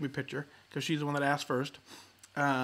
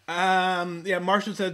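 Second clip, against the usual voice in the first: ordinary talk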